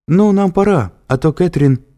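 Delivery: nervous hurry. A narrator's reading. Триш в панике хочет уйти.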